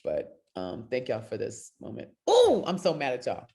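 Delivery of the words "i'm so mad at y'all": ordinary talk, nothing marked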